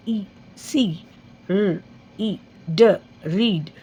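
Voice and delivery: slow blends like बीट, मील, पीक, रीड; long ई sounding out. Shown as a demonstration. Teacher sounding out long ई words letter by letter. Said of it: "हम ई को pronounce करेंगे".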